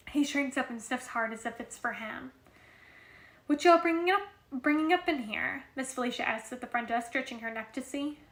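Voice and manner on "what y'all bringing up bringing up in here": loud, raised-pitch nosy question; higher and louder, prying; nosy demand. A narrator's reading. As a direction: call it out as a nosy busybody. Nosy receptionist eyeing their food.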